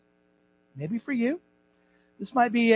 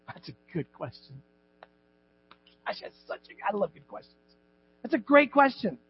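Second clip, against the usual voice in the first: ordinary talk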